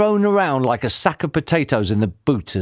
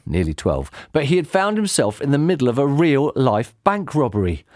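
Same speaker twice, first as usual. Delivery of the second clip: usual speaking voice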